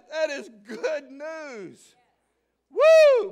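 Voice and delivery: exultant cry. Real, not acted. Exultant preaching climax.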